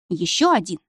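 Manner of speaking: ordinary delivery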